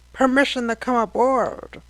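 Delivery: high, loud squawky parrot voice. A narrator's reading. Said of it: "asked in a parrot-like voice".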